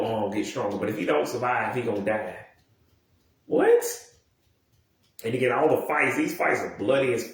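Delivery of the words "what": sudden high, loud squeak of disbelief; mock-shocked yelp, very high-pitched; incredulous. Reacting to a fourteen-year-old told he may die, incredulous.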